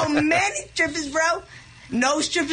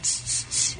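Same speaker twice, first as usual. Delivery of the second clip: punching sounds; 6 dB quieter than usual. Retold or imitated. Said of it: "And Rocco was like".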